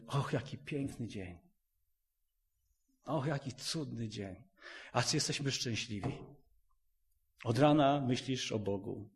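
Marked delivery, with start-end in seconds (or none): 0.1-4.4 soft, content sigh about a good day. Retold or imitated. Husband to wife at bedtime, soft and content. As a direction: murmur it gently like a happy bedtime remark. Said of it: "mówimy".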